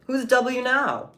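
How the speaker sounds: high, loud and slow gloat; gloating taunt. Acted. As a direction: rub it in, drawn out and loud. Gloating at nuked troll.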